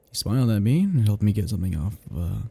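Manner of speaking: ordinary delivery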